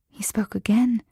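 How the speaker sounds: plain reading voice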